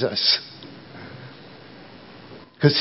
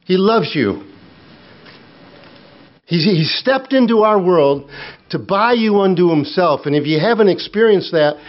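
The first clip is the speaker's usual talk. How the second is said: raised, high-pitched preaching push; fervent preaching; 6 semitones higher than usual. Acted. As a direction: preach it out higher, pressing the call. Preaching at full force.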